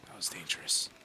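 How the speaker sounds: breathless relieved whisper. Real, not acted. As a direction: exhale the words. After close escape.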